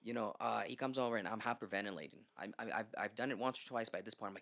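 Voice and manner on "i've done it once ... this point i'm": usual speaking voice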